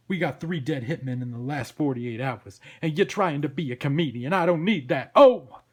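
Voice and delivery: blustering New York detective; exasperated cop Sam. Acted.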